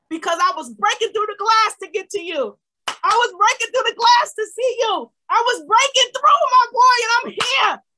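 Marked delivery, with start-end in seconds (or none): 2.9-7.8 high, loud and forceful; full-voiced exultant declaration; triumphant preaching shout. Real, not acted. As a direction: shout it out like a breakthrough testimony. Preacher builds to triumphant testimony.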